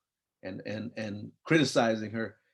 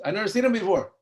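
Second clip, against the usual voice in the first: put-on higher, a puzzled kid protesting; higher-voiced young son talking back; kid's retort; 7 semitones higher, 3 dB louder, faster than usual. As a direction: pitch it up like a confused boy. Child answering his mother.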